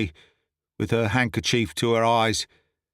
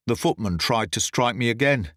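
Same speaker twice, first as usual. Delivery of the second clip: ordinary delivery, nothing marked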